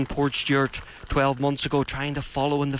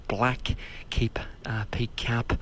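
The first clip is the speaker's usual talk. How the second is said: hushed whisper from the grandstand; 4 dB quieter, slower than usual. Real, not acted. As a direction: drop to a breathy whisper so nobody hears. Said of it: "excuse me for having to just whisper a bit now".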